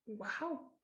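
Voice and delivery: very soft, underwhelmed wow; quietly imitated lukewarm response; muted reaction. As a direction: murmur it as friends politely taking it in. Friends' muted reaction, acted.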